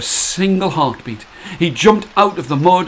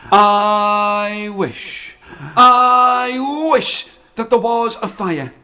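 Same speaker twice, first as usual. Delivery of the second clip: slow, loud, higher proclamation; louder grand spell-casting; grand wish; 5 semitones higher, 3 dB louder, much slower than usual. Acted. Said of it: "She pointed to herself and went".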